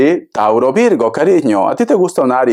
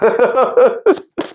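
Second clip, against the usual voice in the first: high laugh; 9 semitones higher than usual. Real, not acted.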